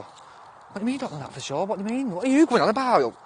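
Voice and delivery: high-pitched, baffled questioning; incredulous challenge. Real, not acted. Streamer disputes a commenter's doubt about the arrest, incredulous.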